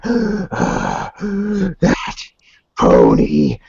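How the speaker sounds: halting words between heavy gasps; acted upset. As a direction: re-enact being too upset to talk, word by word. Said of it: "I'm so upset".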